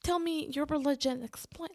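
mock-demanding rapid-fire questions; pushy questioning. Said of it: "not to go full".

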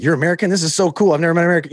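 high, delighted gush of a starstruck local; starstruck excitement. Retold or imitated. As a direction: gush like you've finally met a celebrity. Locals meeting an American for the first time.

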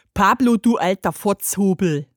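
lallende Trinkerstimme, dunkel; lallend betrunken. A narrator's reading. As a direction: wie ein Betrunkener lallen. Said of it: "lallte eine dunkle Rohrflöte". (lallende Rohrflöte)

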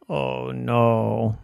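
deadpan robot dismay; drawn-out, even robotic "oh no". Acted. Robot hearing friends are banished, monotone robot voice.

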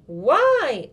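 loud, high protesting why; little sister protesting loudly at the news. Acted. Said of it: "pretty loudly".

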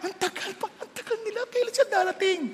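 high, mostly soft complaint; mock-fretting over a long wait; impatient waiting. Preacher playing someone waiting for rescuers, high impatient complaint. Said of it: "Siyempre, nag-aantay ka".

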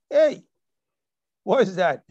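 sudden loud hey. Real, not acted. Preacher challenging the congregation, loud disbelieving call. Preacher mocking unspoken prayer request.

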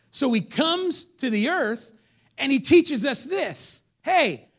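slow, high, loud preaching build; preaching at full force. Acted. Preacher to congregation, rising to full force. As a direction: stretch and build each word. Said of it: "building to preaching peak".

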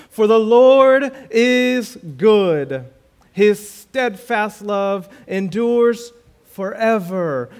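slow, loud, lifted scripture reading; preaching the verses slowly. Acted. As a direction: proclaim the psalm with weight. Reads Psalm in preaching voice.

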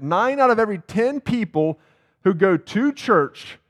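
usual speaking voice

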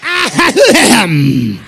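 mock revival preacher warming up; hammed-up preacher cadence; Pentecostal preacher voice. Shown as a demonstration. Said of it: "It's the Pentecostal preacher voice".

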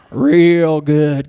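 low Texan drawl, leaned into; Texan accent. Acted. Preacher replaying his God quote, Texan accent. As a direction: savor it in a deep Texan twang. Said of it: "why I said it with a Texan accent".

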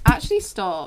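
ordinary talk

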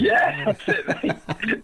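high, drawn-out agreement through laughter; laughing. Real, not acted. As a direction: laugh helplessly as you agree. Joking about [name] singing.

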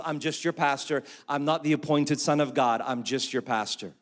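usual speaking voice

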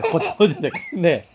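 usual speaking voice

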